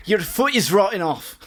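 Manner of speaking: a clumsy Geordie impression; mock Geordie accent. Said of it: "That's almost Scottish".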